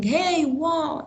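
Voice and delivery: a high, bright exclaim; mock yell. Shown as a demonstration. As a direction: toss it out like an excited shout. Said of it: "exclamation mark for yelling".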